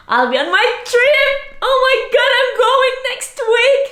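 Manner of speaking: very high, loud squeal of real excitement; excited squeal. Real, not acted. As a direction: squeal with excitement about the trip. Thrilled about upcoming trip.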